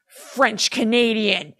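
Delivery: slow, drawn-out mock action-movie line; hammed-up one-liner, then a punched sound effect; badass kicker line. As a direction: deliver it like a punchline before the action. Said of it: "as, like, a kicker line".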